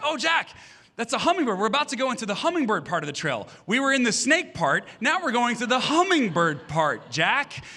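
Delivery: excited dad. Retold or imitated. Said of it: "And I said".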